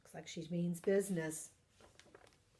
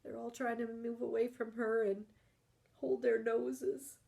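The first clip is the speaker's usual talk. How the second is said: usual speaking voice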